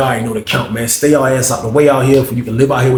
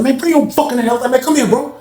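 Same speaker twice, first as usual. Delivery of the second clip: retold confrontation; 9 semitones higher than usual. Confronting snitch in cell.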